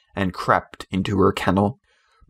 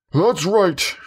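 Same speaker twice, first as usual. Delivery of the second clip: shouting; 8 semitones higher than usual. Acted. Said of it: "he shouted".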